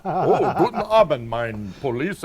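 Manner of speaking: put-on thick German accent, laughing through it. Ex-cop imitating German doctor with German accent. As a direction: mimic a German doctor's greeting. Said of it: "in this really thick German accent".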